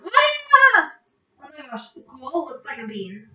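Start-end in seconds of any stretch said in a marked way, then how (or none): 0.0-0.9 high, loud yelp of fright; retold scream. Vlogger telling viewers about the scary hole, mock scream. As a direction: cry out, re-enacting the scare. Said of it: "It was like".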